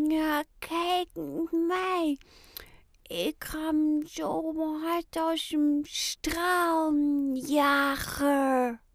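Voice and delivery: mumbled in sleep. A narrator's reading. Ayoub talks in his sleep about racing, slow and dreamy. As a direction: drift through it half asleep. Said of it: "mompelde Ayoub in zijn slaap".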